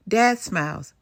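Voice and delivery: usual speaking voice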